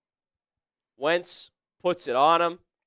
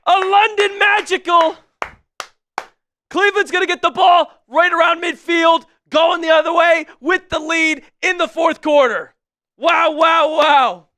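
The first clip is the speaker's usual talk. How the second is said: much higher, loud shouted call of the missed kick; screaming play-by-play; 11 semitones higher, 9 dB louder than usual. Real, not acted. Streamer yelling the missed kick to his chat, real joy. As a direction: scream it like the game just turned.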